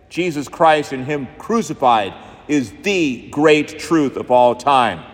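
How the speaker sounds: ordinary talk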